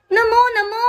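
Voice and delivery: high, babyish imitation of the toddler asking for water; mother imitating her toddler's baby talk. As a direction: say it like a two-year-old asking for a drink. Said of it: "sabi niya".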